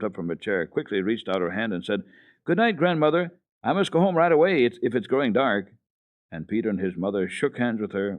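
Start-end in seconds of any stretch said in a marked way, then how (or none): 2.4-5.7 put-on high, louder, urgent little girl; rushing to get home; hurried child. A narrator's reading. Heidi hurrying off.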